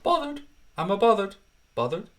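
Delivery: high, mocking catchphrase take on "bothered". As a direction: toss off a cheeky, sulky "bothered?". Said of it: "I wasn't putting the accent on for that".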